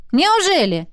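lifted, sceptical query; distrustful. A narrator's reading. Pepper doubts the girls' story, distrustful. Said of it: "недоверчиво переспросил".

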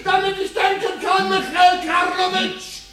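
shouts up at the control room, high and strained; angry shout. Acted. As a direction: demands the mic be switched off, yelling. (wütend rufend)

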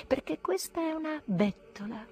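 high-pitched self-justification; defiant. A narrator's reading. Narrator insisting he paid to stay, defiant. Defiant inner rant at company.

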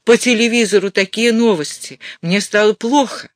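worked-up, offended complaint; indignant. A narrator's reading. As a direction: complain as if outraged. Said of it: "Мать задохнулась от негодования".

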